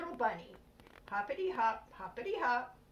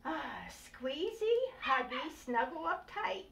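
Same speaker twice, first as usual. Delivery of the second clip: slow, drawn-out snuggly words; a slow cuddly coo; happy and slow; 4 semitones higher, slower than usual. Acted. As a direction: stretch each cozy word out happily. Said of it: "he said happily".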